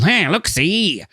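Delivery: high, drawn-out caricature cop; old-time detective voice. Acted. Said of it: "which is a sort of mix of".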